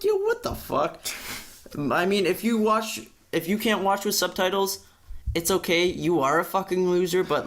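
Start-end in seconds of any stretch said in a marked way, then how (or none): 0.0-1.0 high, sharp disbelief: a squeaky yo; shrieking in mock disbelief; incredulous. Retelling his reaction to a friend, in high disbelief. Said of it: "I'm like".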